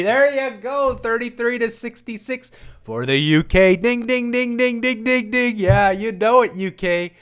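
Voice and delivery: high, bright game-show ding; excited cheer. Real, not acted. Vlogger cheering the UK poll number, high excited dings. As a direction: ring it out like a winning bell.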